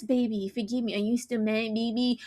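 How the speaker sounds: soft, wheedling imitation; hushed mock begging; mock whispering. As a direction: murmur a put-on apology. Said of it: "whispering in my goddamn camera".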